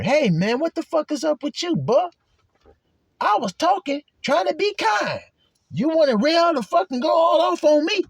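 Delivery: preacher playing a hurt helper snapping back; offended outburst. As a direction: blow up like a snubbed helper. Voicing helper who lashes back.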